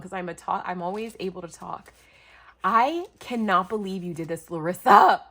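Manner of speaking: ordinary talk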